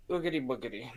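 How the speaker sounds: low, hushed bogeyman noise; lightly voiced bogeyman, soft and low; mock spooky. [name] lurking in the corner, mock spooky.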